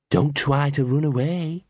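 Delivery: slow, menacing growl; menacing dog voice. Acted. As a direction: threaten slowly like a thug dog. Butch threatens.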